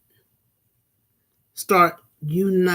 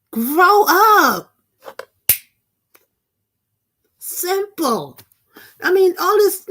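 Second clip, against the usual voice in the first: exasperated scolding; 12 semitones higher, 4 dB louder, slower than usual. Real, not acted. Scolding the feuding public.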